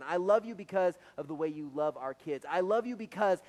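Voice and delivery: loud, fervent declarations of love; preacher modelling love you becauses for the congregation; heartfelt examples. Shown as a demonstration. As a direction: proclaim each reason with conviction. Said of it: "fill in the blank".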